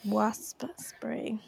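soft breathy whisper. Real, not acted. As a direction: whisper the words back playfully. Repeats the hard words.

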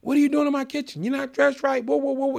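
high-pitched mock yell, rattled off; retold telling-off, voice pushed way up; imitated yelling. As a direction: repeat the boss's scolding high and insistent. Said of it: "He's yelling at me".

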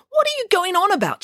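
high indignant demand; demanding pig. A narrator's reading. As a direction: snap it, baffled. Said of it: "she demanded".

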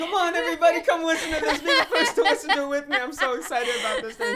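high, loud, laughing imitation of eagerness; hammed-up gushing to round up a crowd; mock excitement. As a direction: tease with over-eager, high-pitched excitement. Mimicking her excitedly gathering coworkers.

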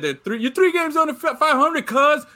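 high, loud mock rant; imitating an angry ranter. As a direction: quote his tirade with rising heat. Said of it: "Like he said".

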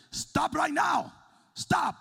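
high, loud, drawn-out shout at the dog; slow yelled command. Retold or imitated. Pastor yelling at the runaway dog, slow loud shout. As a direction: bellow it slowly like a furious owner.

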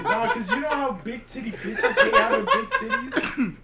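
laughing. Real, not acted. Laughing at own joke.

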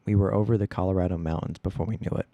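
ordinary delivery, nothing marked

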